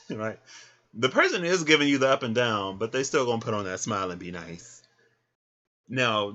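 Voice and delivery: ordinary talk, nothing marked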